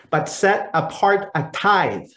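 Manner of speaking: slow, measured reading of scripture; solemn scripture read. Acted. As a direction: read it like a lesson from the pulpit. Priest reads scripture aloud.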